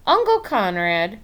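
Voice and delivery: ordinary delivery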